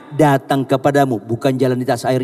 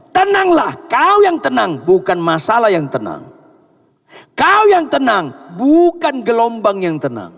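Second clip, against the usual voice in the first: high, loud, stressing kau; loud preacher's command to be calm; emphatic command; 7 semitones higher, 3 dB louder than usual. Acted. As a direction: drive home that you must be calm. Voicing Jesus, emphatic.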